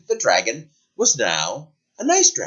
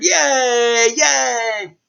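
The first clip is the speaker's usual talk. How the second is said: high, loud excited cheer; crowd cheering; 11 semitones higher, 4 dB louder than usual. Acted. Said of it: "everyone cheered".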